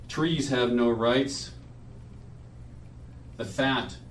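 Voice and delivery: measured poetry reading at a memorial; solemn reading. Acted. Reads his friend's poem aloud.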